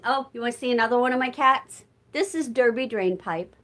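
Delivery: ordinary talk, nothing marked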